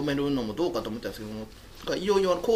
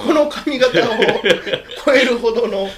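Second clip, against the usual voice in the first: laughing protest; 8 semitones higher, 8 dB louder, slower than usual. Real, not acted.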